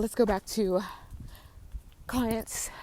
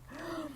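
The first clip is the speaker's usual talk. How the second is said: gasp; 10 semitones higher, 13 dB quieter than usual. Real, not acted. Sees cat up close.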